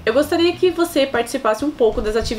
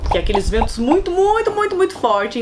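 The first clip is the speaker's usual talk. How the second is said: each muito stretched higher and harder; emphatic muito; 8 semitones higher than usual. Real, not acted. Telling viewers about the typhoon, stressing muito. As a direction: pile on the muitos to show the wind's force.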